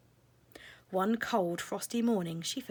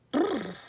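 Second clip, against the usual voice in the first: high, shivering brrr; 6 semitones higher, 7 dB louder than usual. Acted. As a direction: shiver with cold.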